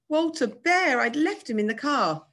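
high, loud cry of dismay; sudden alarm, pitch falling as it sinks in; a stricken high exclamation; dismayed realisation. A narrator's reading. Boy realises teddy was left behind.

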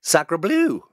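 loud, high hammed-up French exclamation; mock French shock. Host hearing of the French translation, mock French shock. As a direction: cry out in stagey French astonishment.

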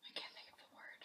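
soft whispered aside; whispering. Real, not acted. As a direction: whisper it to the side. Whispered aside during game.

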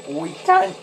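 high, squawky Aussie yell; laconic outback drawl. Pretend. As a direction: bark it like an outback bogan. Mock Aussie car thief bit.

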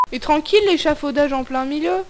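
ordinary delivery

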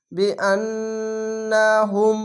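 বিআন্নাহুম with the ন্ন held in a long nasal hum; nasal ghunna. Shown as a demonstration. Teacher showing obligatory ghunna to students, slow. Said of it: "এখানে ওয়াজিব গুন্না".

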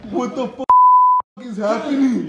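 startled and panicked; real fright as lights flicker; scared. Real, not acted.

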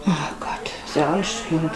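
breathy whisper, a little worn out. Real, not acted. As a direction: sigh it out under your breath. Stöhnt leise über Anstrengung. (geflüstert, erschöpft)

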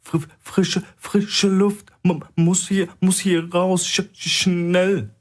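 high, gasping and stammering, slowing at the end; stuttering for air, hammed-up panic; faked panic attack. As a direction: fake a panic attack, short of breath. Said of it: "Celeb zeigte zu den Aufzügen".

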